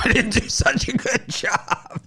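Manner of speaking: words stretched out and pitched high with laughter; laughing. Real, not acted. Host admitting his clean-up failed, laughing hard. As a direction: talk through a high, loud laugh.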